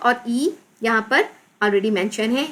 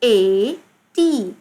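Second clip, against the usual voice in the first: slow, drawn-out letter names; spelling out; much slower than usual. Shown as a demonstration. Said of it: "Again read करेंगे". (धीरे-धीरे, खींचकर हिज्जे)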